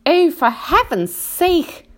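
high, drawn-out exasperation; exasperated earthworm. A narrator's reading. Earthworm scolds the centipede about boots, exasperated. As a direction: drag it out high and loud, fed up. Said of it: "said the earthworm".

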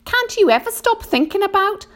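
high-pitched, fed-up complaint; exasperated earthworm. A narrator's reading. Earthworm nags the centipede before being interrupted, exasperated. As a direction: complain in a high voice, cut off mid-thought. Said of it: "said the earthworm".

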